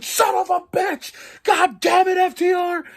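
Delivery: high cursing shout at the TV; furious fan yelling at the screen; retold yell. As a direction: yell it like your team just lost. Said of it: "I was like".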